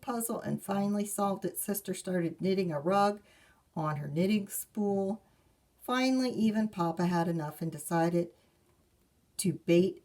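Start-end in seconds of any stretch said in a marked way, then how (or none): none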